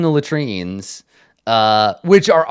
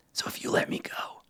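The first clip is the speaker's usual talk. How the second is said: whisper; 13 dB quieter than usual. Acted. Whispered offer, then repeated.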